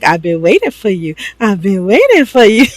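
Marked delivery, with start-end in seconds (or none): 1.4-2.6 higher, playful mock seduction; hammed-up come-on. Wife climbing onto her sleepy husband, hammed-up come-on. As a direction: repeat it as an exaggerated, pleading come-on. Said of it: "she's like".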